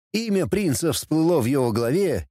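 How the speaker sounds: ordinary delivery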